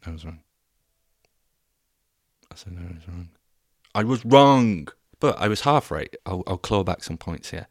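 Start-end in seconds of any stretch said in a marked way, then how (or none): 0.1-3.3 very soft, grudging confession; reluctant admission. Acted. Podcaster admitting a mistake to listeners, quiet and reluctant. 3.9-4.9 much louder, higher exasperated declaration; loud admission. Acted. Podcaster owning up to listeners, loud and emphatic. Same admission, repeated loudly.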